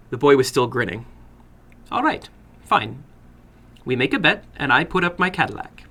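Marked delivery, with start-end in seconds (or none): 1.9-5.9 put-on sly older gentleman with an accent; probing the boy, a touch sly; foreign little man. Narrator voices the little man.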